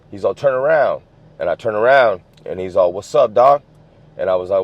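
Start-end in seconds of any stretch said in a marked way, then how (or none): 0.3-1.0 higher imitated command; retold shouted order. Rival ordering kid to turn around, retold call-out. 2.9-3.6 louder, higher imitated street greeting; retold challenge. Rival confronting kid in the street, retold challenge.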